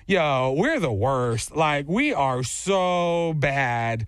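slow, dragged-out retold griping; drawn-out complaining. Friend moaning about the softball team, drawn-out and slow. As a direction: stretch each word like a defeated teammate.

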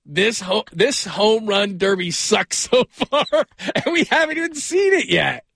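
slow words broken by laughter; giggling while reading it out; laughing. Real, not acted. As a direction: laugh through the line. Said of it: "What's wrong with you?".

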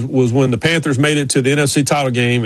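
ordinary delivery

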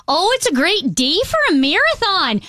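high-pitched, hammed-up northern Minnesota accent; Fargo accent. Shown as a demonstration. Packers fan at a race in Saint Louis, exaggerated Fargo accent. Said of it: "hear my Fargo an-, accent".

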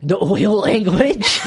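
high, loud, drawn-out disbelief; loud incredulous echo. Real, not acted. Host reacting to co-host's oil language joke, incredulous cry. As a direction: echo it back in amused shock.

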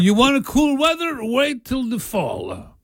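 sing-song Italian rhyme; Italian accent. Pretend. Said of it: "rhyme bit in Italian accent".

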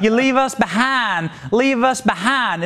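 imitating a Southern drawl, lightly; a mocking take on AOC's Southern accent. Host mocking AOC's Southern accent to his cohost, mock Southern accent. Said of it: "I heard her Southern accent this week".

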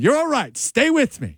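high, insistent plea; urgent reassurance. Retold or imitated. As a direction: keep a dying man with you. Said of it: "this guy's yelling at me".

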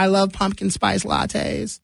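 a mild mock of the cheerful weatherman cooing about lattes; imitating the weatherman. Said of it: "he's always talking about".

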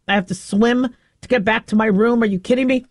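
ordinary talk

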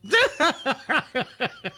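bright high burst of laughter. Real, not acted. Laughs at the joke.

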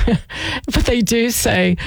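laughing through the words. Real, not acted. Radio host amused by a survey result, laughing as she reads it. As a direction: let laughter break up the sentence. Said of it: "That's what I was laughing at".

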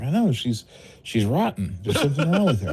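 high, laughing, mock disgusted. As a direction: laugh while accusing. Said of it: "I'm like".

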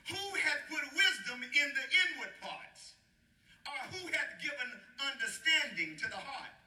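ringing pulpit reading; preaching scripture. Acted. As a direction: proclaim each phrase high and measured. Declaims scripture to congregation.

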